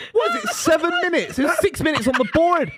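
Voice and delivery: laughing rant. Real, not acted. Ranting while laughing.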